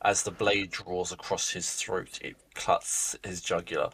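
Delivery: low, soft, slow scene setting; game master narration. A narrator's reading. Game master describes the rogue's kill, hushed narration. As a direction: narrate the kill quietly and deliberately.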